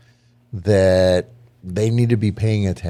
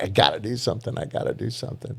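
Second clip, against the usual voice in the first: softer, sinking into a whisper; a restless refrain that fades to a whisper; anxious inner voice; 6 dB quieter, faster than usual. Retold or imitated. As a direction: mutter it to yourself like a sleepless worrier.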